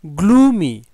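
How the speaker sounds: teacher modelling গ্লুমি as glu-mi; model pronunciation. Shown as a demonstration. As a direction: announce গ্লুমি as glu-mi for students to copy. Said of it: "প্রথমে আমরা উচ্চারণগুলো জেনে নেব".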